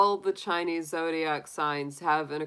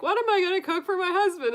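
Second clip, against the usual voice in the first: fretting housewife; 14 semitones higher, 4 dB louder, faster than usual. Retold or imitated. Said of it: "this gal is like".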